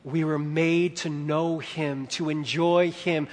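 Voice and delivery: ordinary talk, nothing marked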